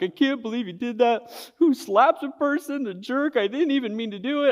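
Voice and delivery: put-on high, tearful kid whine on the verge of crying; high, upset schoolboy complaint; teary whining. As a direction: whimper it like a hurt kid holding back tears. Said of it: "tears are filling up in my eyes".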